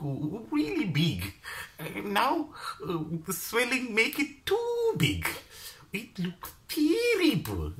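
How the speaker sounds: high, slow exaggerated Chinese accent; strong Chinese accent. Stall owner describing her nose, slow high Chinese accent. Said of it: "with that strong Chinese accent".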